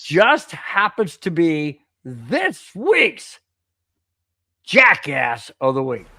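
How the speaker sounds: slow, drawn-out segment intro; mock-grand radio announcement; show host announcing. Real, not acted. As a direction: stretch the words to build to the reveal.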